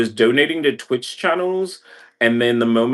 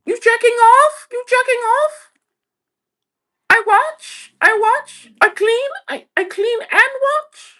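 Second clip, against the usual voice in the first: mock housekeeper; 6 dB louder than usual. Mocking a housekeeper knocking at the apartment, shrill caricature. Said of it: "even housekeeping would be like".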